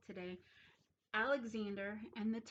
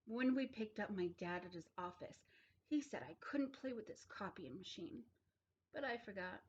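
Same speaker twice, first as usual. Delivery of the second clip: ordinary delivery, nothing marked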